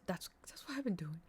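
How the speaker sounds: confessing in a whisper; sheepish whispered admission. Real, not acted. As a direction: hush it like a guilty secret.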